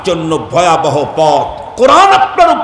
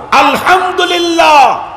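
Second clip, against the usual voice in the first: joyful shout; 9 semitones higher than usual. Real, not acted. Preacher exclaims praise.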